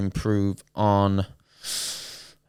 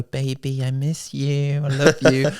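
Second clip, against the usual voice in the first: put-on higher, teasing little-kid voice; mocking kid; 4 semitones higher, slower than usual. Kid in the game lobby mocking his phone call, higher teasing voice. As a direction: mimic a kid taunting a lovesick player. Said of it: "some little kid in the background go".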